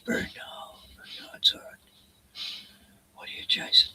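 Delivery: soft soothing whisper. Real, not acted. Owner whispers softly to his dog having a bad dream.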